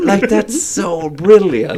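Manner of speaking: slow, laughing delight. Real, not acted.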